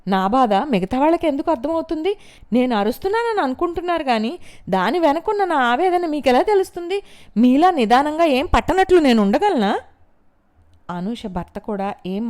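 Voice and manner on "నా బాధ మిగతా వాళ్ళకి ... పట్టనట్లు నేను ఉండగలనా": high, loud furious outburst; anguished shouting, much higher; lashing out. A narrator's reading. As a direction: tear into her mother-in-law. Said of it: "ఆవిడ మీద విరుచుకుపడింది".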